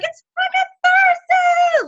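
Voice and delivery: very high, slow, stretched party yell; excited shout. Retold or imitated. Coach hyping herself up for Thursday, shouting excitedly. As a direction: belt it like the weekend starts now. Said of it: "I get so excited".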